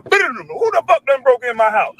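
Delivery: slow, drawn-out furious demand; mock angry bust-in. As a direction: storm the door and demand an answer. Kicking in son's door.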